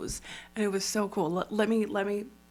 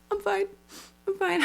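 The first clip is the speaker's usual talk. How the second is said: high, tight voice holding back tears; choked-up I'm fine; 11 semitones higher, faster than usual. Retold or imitated. Teary-eyed at a contestant, squeaky choked-up voice. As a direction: pretend you're not about to cry. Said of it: "I just get a little, like".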